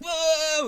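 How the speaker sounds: high, loud "whoa"; a loud, rising whoa like something shooting past; mimicked whoosh. Mimics sudden speeding off.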